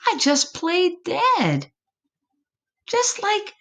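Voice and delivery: put-on lightly high, proud child voice. Possum child telling mama how he played dead, lightly proud. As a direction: boast that you were clever.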